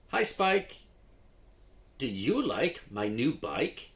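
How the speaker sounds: lively storybook read with character voices; reading to children. Acted. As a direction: act out the story for young readers. Reads phonics story with voices.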